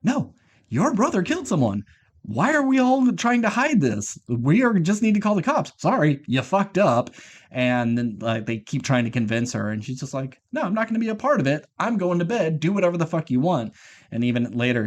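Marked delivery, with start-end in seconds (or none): 0.0-7.1 high-pitched, indignant mock objection; exasperated protest. Wife objecting to hiding a body, exasperated protest. 10.5-13.7 high-pitched mock refusal; indignant refusal. Wife refusing to be part of it, indignant.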